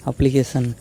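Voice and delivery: ordinary delivery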